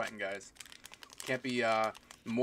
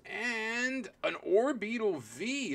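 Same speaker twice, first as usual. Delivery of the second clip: excited reveal; 10 semitones higher, much slower than usual. Real, not acted.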